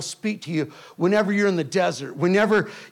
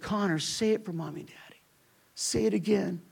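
whisper; 9 dB quieter than usual. Retold or imitated. Wife leaning over son's bed.

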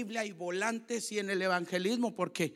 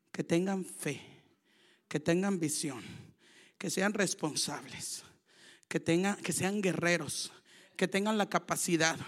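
ordinary talk

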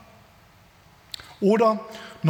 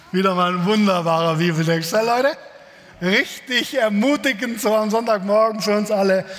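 dry sarcasm about an encouraging text; ironic. Real, not acted. Pastor joking to the congregation about the harsh text, ironic. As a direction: praise the text with a wink.

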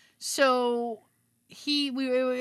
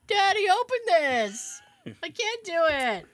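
put-on higher, slow, pleading little-girl plea to dad; lightly voiced mom asking daddy for help; imitating mom; 8 semitones higher, 3 dB louder than usual. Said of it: "My mom do something".

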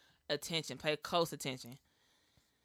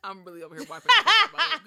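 laughing; 9 semitones higher, 13 dB louder, faster than usual. Real, not acted. Laughing while wiping lip.

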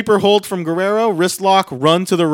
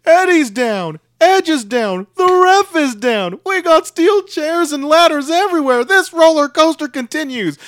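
high, loud commentator imitation; excited play-by-play yell, pitched way up; Michael Cole yelling; 12 semitones higher, 5 dB louder than usual. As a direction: mimic a wrestling announcer's frantic call. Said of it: "as he yelled".

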